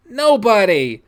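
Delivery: loud, high, defensive retort; defiant denial. Acted. Child denying to angry dad, defiant retort. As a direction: throw the answer back at him.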